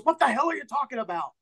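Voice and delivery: high-pitched indignant demand; irate bar owner. Acted. Bar owner to a customer pitching rum, irate high voice. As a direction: demand it with exasperation, pitch jumping up.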